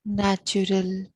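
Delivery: very slow, drawn-out meditation guidance, long pauses between phrases; guided meditation. Real, not acted. Host guiding listeners to watch their breath, slow meditative voice. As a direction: lead them into stillness, unhurried and even.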